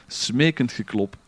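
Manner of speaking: usual speaking voice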